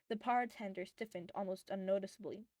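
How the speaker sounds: usual speaking voice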